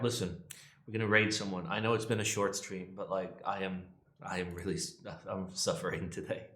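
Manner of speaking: ordinary talk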